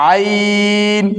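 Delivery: ain voiced from mid-throat; Arabic letter ain. Shown as a demonstration. Teacher to learners, demonstrating আইন from the throat. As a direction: squeeze আইন from the middle of the throat. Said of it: "হলকের মধ্যখান হইতে ভিতর থেকে আসবে".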